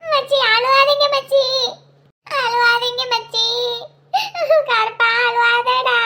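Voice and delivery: slow, drawn-out weepy consoling; tearful pleading. Acted. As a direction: beg them slowly, close to crying. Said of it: "நீங்க அழுவுறத பார்த்தா எனக்கு அழுகையா வருது". (அழுகையோடு மெதுவாகக் கெஞ்சுதல்)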